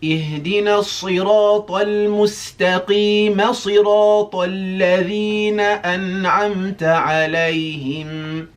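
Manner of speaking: high, loud melodic chant; Quran recitation. Shown as a demonstration. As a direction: recite with full tajweed melody.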